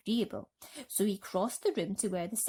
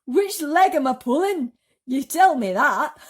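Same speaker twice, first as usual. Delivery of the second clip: higher, louder laughing taunt; gleeful; 7 semitones higher, 13 dB louder than usual. Acted. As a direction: laugh through the joke. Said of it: "wriggling with glee".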